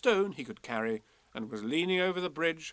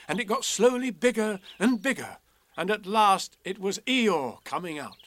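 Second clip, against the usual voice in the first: building suspense; 7 semitones higher, 7 dB louder than usual. A narrator's reading. Narrator builds to Eeyore's reappearance.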